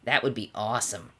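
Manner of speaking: cool, impressed tomboy; Rainbow Dash. A narrator's reading. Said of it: "asked Rainbow".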